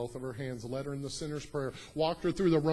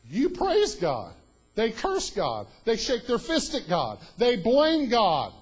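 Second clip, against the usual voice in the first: loud, high preaching, slow and building; preaching at full force; 9 semitones higher, 9 dB louder, slower than usual. Acted. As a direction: hammer each line home to the crowd.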